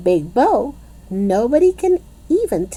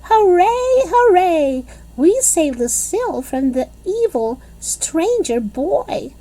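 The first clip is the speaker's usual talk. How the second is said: triumphant cheering; 13 semitones higher than usual. A narrator's reading. Junie B cheering to Grace that they saved Lucille, triumphant. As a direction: crow about the rescue. Girls cheering their rescue.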